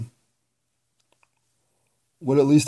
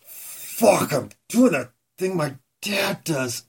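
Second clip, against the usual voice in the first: horrified realization; 7 semitones higher, slower than usual. Retold or imitated. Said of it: "I'm like".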